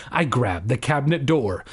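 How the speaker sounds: ordinary talk, nothing marked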